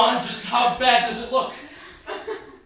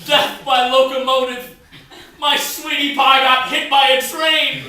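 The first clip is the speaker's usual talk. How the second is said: melodramatic grief; 5 semitones higher, 6 dB louder than usual. Acted. Melodramatic grief over wife.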